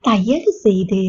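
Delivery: ordinary talk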